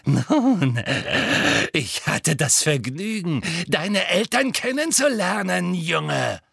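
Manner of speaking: drawn-out, evil smiling menace; malicious purr with an audible smile; wicked villainess. A narrator's reading. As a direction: savour a cruel memory, toying with the boy. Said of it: "ein wahrhaft böses Lächeln".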